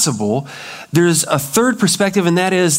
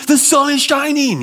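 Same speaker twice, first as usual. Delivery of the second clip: high mock shout of a text; all-caps yelling; 11 semitones higher than usual. Shown as a demonstration. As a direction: read a text message as if yelling it. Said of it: "you're yelling all the time".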